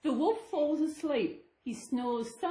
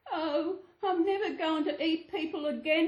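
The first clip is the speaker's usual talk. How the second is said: groaning; 6 semitones higher than usual. Acted. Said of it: "he groans".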